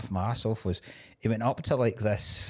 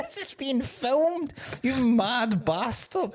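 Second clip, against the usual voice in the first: incredulous outburst; 11 semitones higher, slower than usual. Retold or imitated. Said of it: "You're like".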